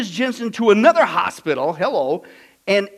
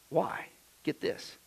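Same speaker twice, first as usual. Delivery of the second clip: hushed whisper to draw them in; a secret whispered to the congregation; whispered aside; 3 semitones lower, 11 dB quieter, slower than usual. Acted. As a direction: lean in and whisper it. Hushed aside before the reveal.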